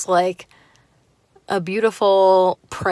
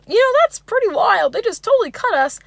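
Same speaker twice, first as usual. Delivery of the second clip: high, loud goofy mock voice; overzealous Bill Cosby; 14 semitones higher, 6 dB louder than usual. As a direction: imitate an overeager date's amazement. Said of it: "overzealous Bill Cosby meets".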